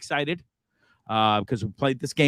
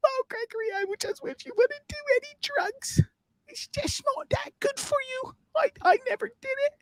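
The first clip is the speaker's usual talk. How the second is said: high falsetto mother, softer and pleading; mom voice; 5 dB quieter than usual. Acted. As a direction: coo it like a worried mom. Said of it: "I know, Mom".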